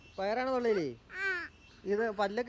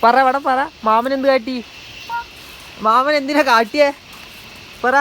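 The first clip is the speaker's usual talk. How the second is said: louder, higher demand for an answer; stern pressing; 7 semitones higher, 6 dB louder than usual. Real, not acted. Uncle pressing the child to answer. (ഉച്ചത്തിൽ കർശനമായി ചോദിക്കുന്നു)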